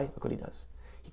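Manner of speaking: hushed conspiratorial whisper; whispering to draw listeners in. Acted. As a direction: lean in and whisper the secret. Conspiratorial aside in story.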